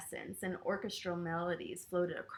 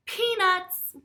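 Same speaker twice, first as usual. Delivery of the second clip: excited reveal; 10 semitones higher, 14 dB louder than usual. A narrator's reading. Story reveal.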